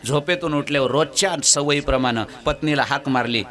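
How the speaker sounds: ordinary delivery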